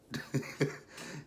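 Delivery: breathy little laugh to oneself; amused chuckle. Real, not acted. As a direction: chuckle before telling the funny bit. Amused by the quote coming next.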